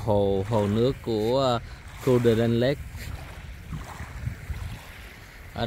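calm, low vlog voice-over; travel narration. Acted. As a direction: describe the lake like a guide. Tour guide narration for the camera.

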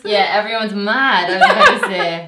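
laughing loudly through the words. Real, not acted. Laughing about the locals.

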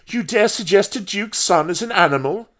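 high-pitched, affronted question; indignant demand. A narrator's reading. Paul challenges the old woman's insult, indignant demand. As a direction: demand it with wounded pride. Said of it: "he demanded".

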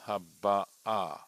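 final "a" of "haba'a" stressed. Shown as a demonstration. Teacher showing a Hebrew accent, "haba'a" stressed on the last syllable. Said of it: "The accent is on the a".

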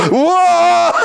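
high loud startled whoa with a laugh; surprised whoa. Retold or imitated. Man reading about drink, startled whoa. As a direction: yelp it out in surprise.

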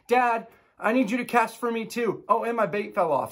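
put-on child begging dad to cast; next kid asking. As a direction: play the kid tugging at dad. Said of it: "the next kid comes up to me".

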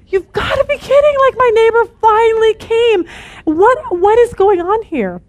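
high, loud, exasperated cry of disbelief; mortified disbelief. Retold or imitated. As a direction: squeal it out, horrified the neighbor picked this day. Mortified churchgoer, neighbor finally came.

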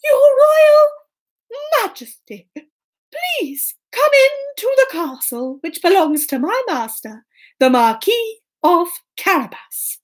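high, slow, ceremonious cat voice; grand storybook cat, high and stately; courtly Puss. Acted. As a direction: welcome royalty with a drawn-out grand announcement. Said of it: "And Puss said".

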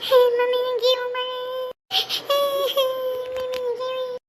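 high wail for mother; child crying. Acted. Child crying to mother, high wail.

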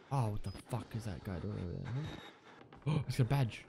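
usual speaking voice

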